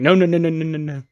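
a hammed-up frantic plea to stop them; mock panicked no. [name] begs them not to break spotlight.

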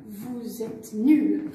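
very slow, low, cutting verdict; slow insult. Acted. Cali tells Jules he's useless, slow and low. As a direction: drop it on him slowly.

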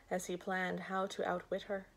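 usual speaking voice

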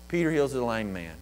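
usual speaking voice

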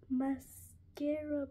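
slow, stretched announcement; slow excited unveiling; drawn-out reveal. Real, not acted. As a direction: draw the word out in delight.

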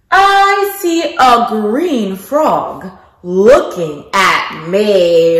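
lilting picture-book narration with big questions; reading to children. Acted. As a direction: read it like circle time for little kids.

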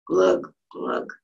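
breathy whispered sinking glug. Acted. Whispering the frog's glug to the kids. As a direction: whisper the frog going under. Frog sinks in pool.

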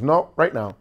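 loud, high retold order; insistent command. Owner's wife wants the audition immediately, loud and insistent. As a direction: imitate a boss refusing to wait, loudly. Missus Ditka overruling his stalling.

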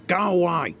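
high, loud, cross shout; angry snap. A narrator's reading. Mister Grumpy sends Mister Happy away, shouting crossly. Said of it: "snapped Mister Grumpy".

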